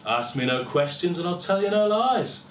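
put-on a teenage wisecrack back at mum; trying to be smart. Son answering his suspicious mother, cheeky smart-alec retort. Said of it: "trying to be smart".

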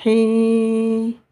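"Hi." with a long sustained i; long vowel. Shown as a demonstration. Teacher shows the same for i, "Hi." held long. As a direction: stretch "Hi." on one even note. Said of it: "Même chose pour".

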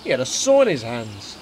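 ordinary delivery

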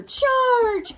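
high, loud storybook charge; battle cry. Acted. Reader acting the knights fighting the dragon, loud battle cry. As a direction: call it out like a knight attacking.

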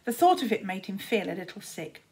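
ordinary delivery

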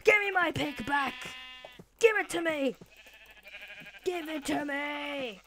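high, loud demand; mock-angry shout. Player demanding a pick back, shouting. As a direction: yell it like a player robbed.

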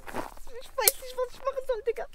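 high, soft, helpless whine; small high worried voice; scared whining. Acted. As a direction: fret to yourself in a high voice. Alone and scared in the woods.

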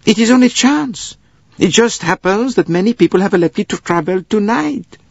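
put-on high, placating accented official; protesting conductor. A narrator's reading. Conductor assures Bouc it is chance, high and placating. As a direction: explain helplessly in a high voice.

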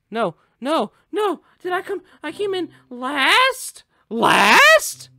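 high, loud disbelieving cry; shocked disbelief. Real, not acted. Gamer finding he came in last, loud disbelief.

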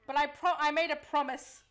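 loud, exasperated insistence; loud protest. Real, not acted. Streamer insisting to chat about a promise, loud protest. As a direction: protest it at full voice. Protesting chat's plea to end.

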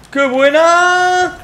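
'buena' stretched very high and slow; drawn out high. Real, not acted. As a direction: sing out 'buena' high and long.

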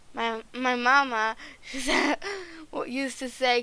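slow, laughing through the words. Acted. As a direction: chuckle as you remember. Laugh heard while retelling.

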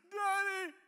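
high, drawn-out mock wail; pleading toddler. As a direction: beg dad like a sobbing little one. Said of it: "he says the most audacious thing".

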